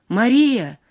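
loud narrated call of a name. A narrator's reading. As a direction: call after someone leaving, voice lifted. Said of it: "крикнул Иван". (громкий оклик)

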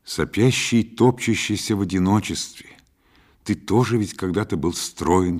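ordinary delivery, nothing marked

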